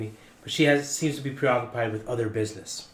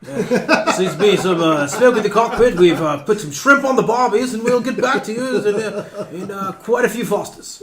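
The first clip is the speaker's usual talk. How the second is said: hammed-up Australian pilot, much higher and louder, laughing through it; mock Australian accent; 8 semitones higher, 11 dB louder than usual. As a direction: play a jokey Australian captain on the intercom, cracking up. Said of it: "does he have an accent".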